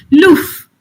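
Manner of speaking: Yorkshire vowel, love said as loof. Retold or imitated. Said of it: "love is pronounced as loof".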